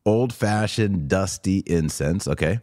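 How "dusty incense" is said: ordinary talk, nothing marked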